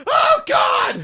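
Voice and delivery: very high, loud hammed-up cry; mock anguished shout. Tortured prisoner crying out, mock anguished shout. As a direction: howl like a man in agony.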